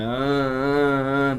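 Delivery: slow drawn-out moans into the mic; four slow breathy moans; moaning. Shown as a demonstration. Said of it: "just moan into the mic".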